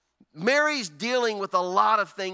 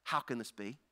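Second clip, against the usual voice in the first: hushed wonder; 11 semitones lower, 12 dB quieter than usual. Retold or imitated. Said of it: "it wasn't just a negative".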